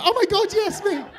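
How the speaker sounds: high and loud, thrilled to be picked; giddy excited squeal. Retold or imitated. Mimicking a thrilled singer picked from the crowd, excited squeal. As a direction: squeal it like a Eurovision pick. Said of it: "They're like".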